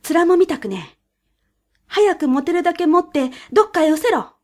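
high, loud, irritated weasel; angry weasel. A narrator's reading. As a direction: snap at him like a cross weasel. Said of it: "イタチはプリプリして".

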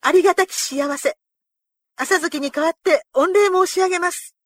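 high, loud, formal gratitude; fervent thanks. A narrator's reading. Retainer thanking his lord on the horse's behalf, fervently. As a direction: thank your lord with full-voiced fervour.

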